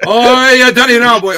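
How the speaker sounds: loud, high hammed-up Irish voice; Irish accent. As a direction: lay on a shaky-at-best stage Irish. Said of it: "will read the top ten in an Irish voice".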